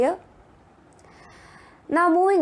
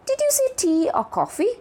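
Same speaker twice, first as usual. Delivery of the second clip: high-pitched question, rising on "coffee"; rising question intonation; 12 semitones higher than usual. Shown as a demonstration. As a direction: ask it high, lifting "coffee" at the end. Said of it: "There is also a rising intonation".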